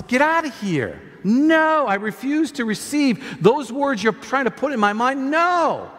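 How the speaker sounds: high, emphatic preacher's refusal; forceful rebuke. Acted. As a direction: drive the devil out, hitting each no. Acts out rebuking the devil.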